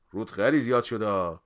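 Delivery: rebuking. A narrator's reading. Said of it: "بغوث گفت".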